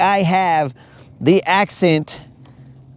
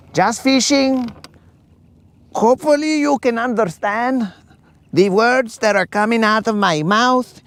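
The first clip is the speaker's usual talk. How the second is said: hammed-up Mexican-accented English, pitched higher; exaggerated Mexican Spanglish fishing-host voice, higher; mock heavy Mexican accent; 7 semitones higher than usual. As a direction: play the thick Mexican accent the commenter expects, higher voice. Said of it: "I guess we'll have to give him a heavy accent".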